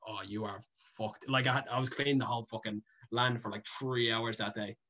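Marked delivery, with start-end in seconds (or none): 0.1-1.3 low, slow, very soft verdict; hushed mock warning; imitated ominous mother. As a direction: drop it right down, slowly, as mom. Mother finding the mess, retold.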